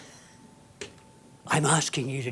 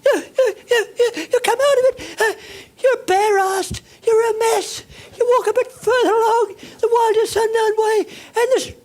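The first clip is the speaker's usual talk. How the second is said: wailing victim; 8 dB louder than usual. Acted. Comic disaster story, wailing.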